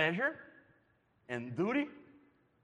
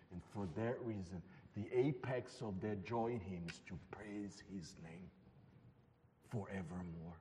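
hushed tender preaching; 12 semitones lower, 5 dB quieter than usual. Acted. Preacher consoling the children about the cross, hushed and tender. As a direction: draw the congregation close and speak gently.

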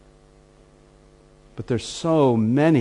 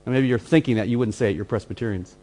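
ordinary talk

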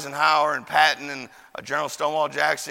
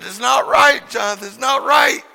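high, loud protest; outraged cry; 5 semitones higher, 7 dB louder than usual. Retold or imitated. Preacher to beaten Jonathan, outraged shout. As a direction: cry out against the wrong. Retold outrage at a boy's beating.

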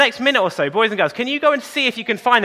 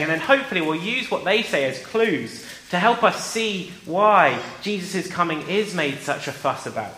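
ordinary talk, nothing marked

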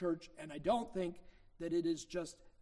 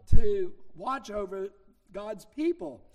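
louder, slower, driving home the point; preaching emphasis; 16 dB louder, slower than usual. Acted. The preacher urging elders to watch over the flock, loud emphasis. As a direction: lean on it like a preacher.

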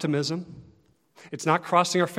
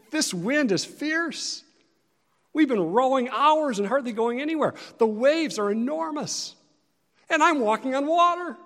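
higher, strained voice of Peter in the storm; Peter on the water; 8 semitones higher than usual. Acted. Peter on the stormy lake, fearful and amazed. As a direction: play Peter, awed and scared mid-storm.

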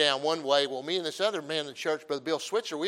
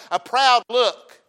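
emphatic; 6 semitones higher, 9 dB louder, much slower than usual. Acted. Quoting scripture with force.

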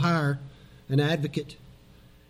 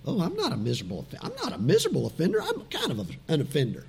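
mock denial; 8 dB quieter, much faster than usual. Mocking churchgoers who deny sin.

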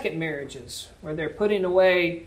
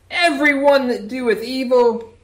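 mock pious claim; 8 semitones higher, 8 dB louder, slower than usual. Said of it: "you say".

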